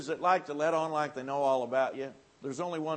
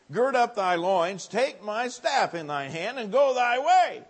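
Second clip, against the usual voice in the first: louder, higher preaching; preaching at full force; 7 semitones higher, 9 dB louder than usual. Acted. Preacher building up Elisha's command to the congregation. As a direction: build the scripture up loud.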